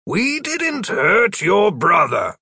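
slow, deliberately firm; being firm voice. A narrator's reading. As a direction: try to sound firm with a child. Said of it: "trying out his being firm voice".

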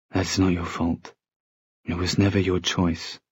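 soft, hushed absolution; gentle reassurance. A narrator's reading. Jem telling Tessa she is blameless, soft and gentle. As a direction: comfort softly, almost under the breath.

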